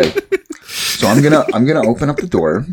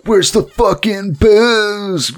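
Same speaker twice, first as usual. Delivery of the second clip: high, slow drawn-out party-bro demand; bro yell; 11 semitones higher, much slower than usual. Acted. Chad pushing past the women, high slow bro bellow. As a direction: barge in like an obnoxious frat bro. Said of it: "and he's like".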